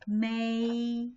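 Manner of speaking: MAY held long and level; first tone. Shown as a demonstration. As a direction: stretch MAY out on one steady pitch. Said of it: "May is the first tone".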